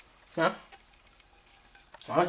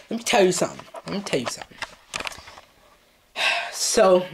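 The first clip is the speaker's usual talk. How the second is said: usual speaking voice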